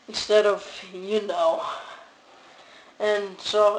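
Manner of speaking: ordinary delivery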